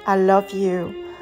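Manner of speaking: ordinary delivery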